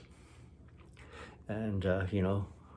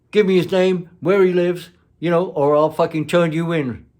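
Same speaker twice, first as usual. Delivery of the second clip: louder, higher, a demanding yell; threatening shout; 6 semitones higher, 9 dB louder than usual. Retold or imitated. Park ranger threatening her for the dealer's name, shouting. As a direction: bark the demand like an angry cop.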